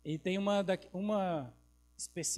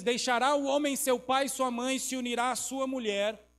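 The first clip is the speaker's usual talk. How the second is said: loud proclamation; 4 semitones higher, 5 dB louder than usual. Real, not acted. Preacher proclaiming scripture.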